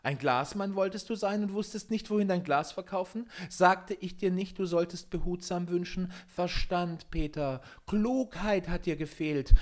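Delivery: high, small gnome voice, reproachful; indignant little forest spirit; little glass man. A narrator's reading. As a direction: scold in a tiny high-pitched voice. Little glass man scolds Peter.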